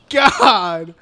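usual speaking voice

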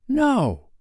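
high, short, carefree reply; playful boy's quick answer; blithe boy. A narrator's reading. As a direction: answer instantly, pitched high. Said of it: "he answered blithely in his own voice".

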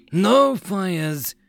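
high-pitched, voiced urgent warning; frightened whisper. A narrator's reading. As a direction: warn them with a touch of fear. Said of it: "the voice whispered frighteningly".

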